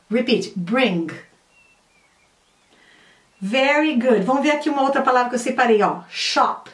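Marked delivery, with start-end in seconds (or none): none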